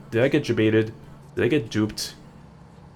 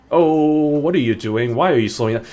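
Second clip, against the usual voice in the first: loud, higher mock-outraged complaint; exasperated road rage; 5 semitones higher, 6 dB louder than usual. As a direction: complain loudly at the car ahead. Complaining at car slowing ahead.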